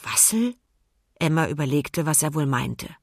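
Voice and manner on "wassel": hoch, mit steigendem Ende; rätselnde Frage. A narrator's reading. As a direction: frag verwundert nach. Emma rätselt über das Wort. (ratlos und hoch nachgefragt)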